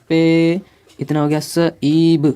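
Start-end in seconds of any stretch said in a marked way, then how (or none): 0.1-0.6 "पे" held long on its own; slow sounding-out of "पे"; drawn-out syllable. Shown as a demonstration. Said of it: "इसको क्या पढ़ सकते हैं". 1.4-2.3 "सईब" slowly as sa, ee, b; slow letter-sound breakdown of "सईब"; sounded out in parts. Shown as a demonstration. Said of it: "इसे हम passive पढ़ेंगे".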